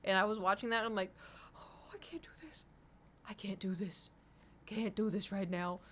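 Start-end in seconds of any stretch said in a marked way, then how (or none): 1.6-5.8 overwhelmed whisper. Retold or imitated. Overwhelmed by sad puppy video.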